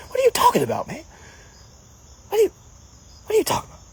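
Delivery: high, exasperated disbelief; mock outrage at a silly remark; incredulous. Real, not acted. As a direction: squeal it out like you can't believe the comment.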